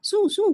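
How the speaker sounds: high sharp shoo to scare birds; mock shooing sound; shooing hiss. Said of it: "मुंह से".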